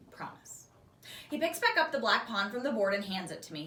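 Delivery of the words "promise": whispered promise. Acted. Solemn reply to Bob.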